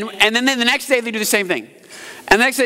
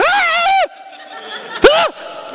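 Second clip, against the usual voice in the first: silly shrieking battle yell breaking into a laugh; mock war cry. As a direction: scream at the wall like a fool. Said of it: "I just went".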